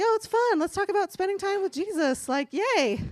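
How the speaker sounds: high, chirpy fake-cheerful voice; sing-song sarcastic cheer; mock bubbly fun. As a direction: play up the perky churchy enthusiasm. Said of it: "I do fun, like".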